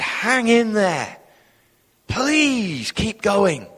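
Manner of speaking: high, drawn-out plea; encouraging. Retold or imitated. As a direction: urge them on slowly, stretching the words. Acting out Barnabas urging believers on.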